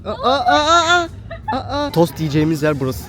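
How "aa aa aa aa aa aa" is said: high, loud playful cheering; high teasing cheer. Real, not acted. Teasing cheer at partner's walk.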